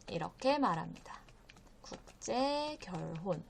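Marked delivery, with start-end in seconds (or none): 1.8-3.4 softer, slow 국제 결혼; slow vocabulary modelling. Shown as a demonstration. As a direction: draw out 국제 and 결혼 with a gap. Teacher repeats the new term.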